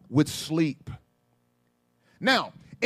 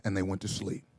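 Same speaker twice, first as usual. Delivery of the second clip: much softer, lower, intimate sermon; soft reflective preaching; 11 semitones lower, 8 dB quieter than usual. Acted. Preacher quieting down to let the point land, soft. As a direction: draw them in with a hush.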